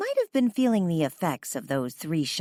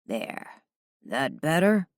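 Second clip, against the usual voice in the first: gruff sarcasm; slower than usual. A narrator's reading. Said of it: "he asked with gruff sarcasm".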